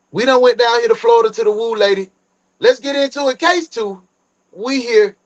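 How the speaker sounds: high, fast, pumped-up host; hype announcer voice. Acted. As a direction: kick off the case like a hype man. Streamer hype intro to case.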